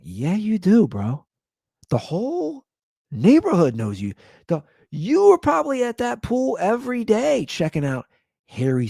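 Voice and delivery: high-pitched, playful call-out; hammed-up, high incredulous needling; mock accusing. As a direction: tease him as if catching him in a fib. Mocks the neighbor's denial.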